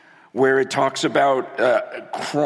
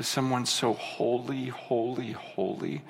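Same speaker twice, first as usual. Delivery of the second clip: hushed awe; 4 semitones lower, 10 dB quieter than usual. Real, not acted. Awe over God's holiness.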